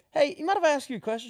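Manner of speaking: quick, high-pitched lead-in to a question; curious tattooist. Acted. Tattooist asking his customer about her odd requests, curious.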